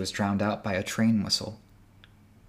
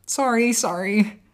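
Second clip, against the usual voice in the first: slow, hurried-sorry apology, raised; apologizing; 11 semitones higher, 4 dB louder, slower than usual. Acted. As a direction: apologize while pushing past. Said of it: "Avery apologized".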